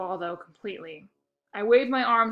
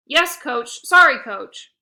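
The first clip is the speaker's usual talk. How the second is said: apologetic; 4 semitones higher, 13 dB louder, slower than usual. A narrator's reading. Player apologizing.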